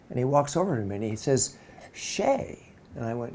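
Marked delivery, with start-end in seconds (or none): 1.9-2.6 SHAY pitched high, rising at the end; imitating someone checking the name SHAY; questioning name. Director greets him, questioning the name.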